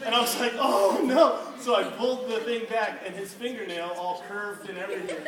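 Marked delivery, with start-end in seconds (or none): 0.6-1.4 loud, high, laughing cry of alarm. Retold or imitated. To the youth group, a loud laughing oh no. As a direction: yelp it out mid-laugh. Watching the fingertip come off.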